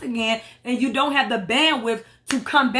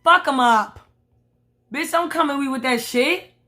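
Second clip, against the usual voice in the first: normal talking voice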